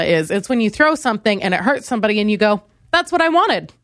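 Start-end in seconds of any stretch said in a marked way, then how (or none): 2.9-3.7 high-pitched diva declaration; satisfied diva. Acted. Diva after hurting somebody, high satisfied declaration. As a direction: say it high, pleased with the damage. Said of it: "Like that".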